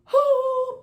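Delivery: sharp, high gasp of shock. Retold or imitated. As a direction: gasp like the breath is knocked out. Shock at pregnancy test result.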